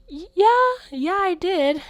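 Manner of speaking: high, loud, stammered yes; a caught kid squeaking out a lie; nervous lie. Retold or imitated. Child lies to her dad.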